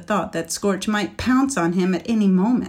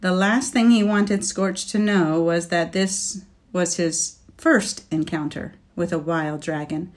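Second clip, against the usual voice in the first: ordinary delivery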